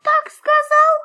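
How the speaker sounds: very high mouse voice; high-pitched tiny creature; squeaky little mouse. A narrator's reading. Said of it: "запищал мышонок".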